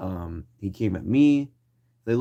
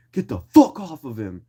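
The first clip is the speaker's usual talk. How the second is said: louder, higher retold yell; imitated protective shout; 5 semitones higher, 8 dB louder than usual. Homie ordering someone off his friend, imitated shout.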